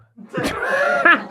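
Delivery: squealing laugh, very high; high shriek of laughter. Real, not acted. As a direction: burst into a shrill laugh. Laughing at his own jab.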